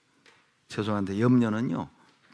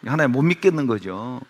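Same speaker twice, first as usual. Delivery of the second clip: usual speaking voice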